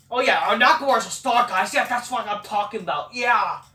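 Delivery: fast, loud, high fanboy gush; mock gushing commentator. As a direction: ham up a commentator losing it over a star. Mocking commentator gushing over Nakamura.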